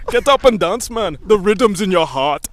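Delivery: loud, high mock Caribbean accent. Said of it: "every Caribbean stereotype".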